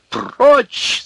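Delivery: very high, full-voiced yell; piercing angry command. A narrator's reading. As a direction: scream it to force him away. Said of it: "закричал тогда Князев громче прежнего".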